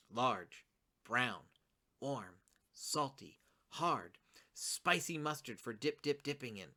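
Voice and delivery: "Large", "brown", "warm", "salty", "hard" each a separate low, falling beat; word-by-word list, building. Shown as a demonstration. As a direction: list the words one by one, then run on into "spicy mustard". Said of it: "It kinda builds up".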